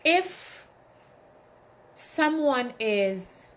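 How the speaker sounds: ordinary delivery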